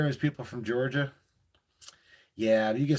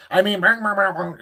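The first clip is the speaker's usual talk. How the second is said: Boomhauer-style rapid talk; 6 semitones higher, 7 dB louder than usual. Retold or imitated.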